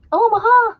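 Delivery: high, loud quarterback call; Peyton Manning. Acted. Broncos quarterback calling the play, high shouted impression. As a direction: call out "Omaha" like a play at the line. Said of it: "Peyton Manning".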